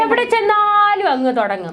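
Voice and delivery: high, drawn-out mocking complaint; exasperated scoffing. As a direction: cut in with slow, high-pitched exasperation. Scoffing at the opponent's repeated boast. (പരിഹാസം കലർന്ന മടുപ്പോടെ, ഉയർന്ന നീട്ടിയ സ്വരം)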